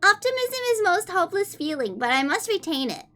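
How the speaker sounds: put-on high, sweet anime-girl reading voice; fake cute voice. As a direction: read it cutesy and high-pitched. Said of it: "I'll read the first page in a kawaii voice".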